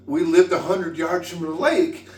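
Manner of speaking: ordinary talk, nothing marked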